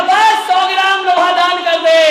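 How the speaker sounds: loud, high, stressing बस; insistent shout. Retold or imitated. Monk shouts at the rich woman to donate just a little iron. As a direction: shout बस to cut her off.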